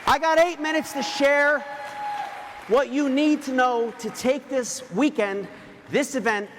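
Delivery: loud, high, slowed preacher's push to the crowd; preaching at full force. Acted. Speaker rallying the event crowd before the weekend, loud preaching. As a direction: drive every word home like a revival preacher.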